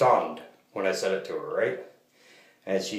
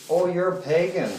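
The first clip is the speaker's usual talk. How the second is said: higher, a little louder mock-surprised woman; imitating the cashier; 9 semitones higher, 4 dB louder than usual. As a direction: lift the voice to play the surprised cashier. Retelling the cashier's words.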